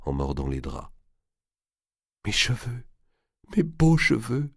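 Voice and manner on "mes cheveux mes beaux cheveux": plainte en pleurs; sanglots. Acted. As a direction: pleurer ses cheveux perdus. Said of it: "sanglotait Blanche". (gémissement sanglotant)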